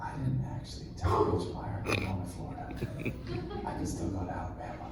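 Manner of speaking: hushed rushed whisper, half laughing; urgent whisper. Retold or imitated. On stage before going live, confessing to his dad in an urgent whisper. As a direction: whisper it fast so the mics won't catch it.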